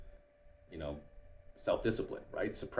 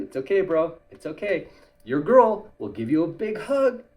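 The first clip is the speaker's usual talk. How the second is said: a hint of a consoling buddy, louder; mock comforting friend; 7 semitones higher, 7 dB louder than usual. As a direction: pat him on the back mockingly.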